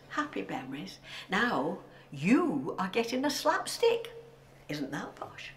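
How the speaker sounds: ordinary talk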